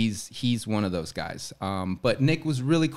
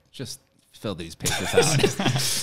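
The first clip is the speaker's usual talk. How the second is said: laughing; slower than usual. Retold or imitated. Quote said laughing.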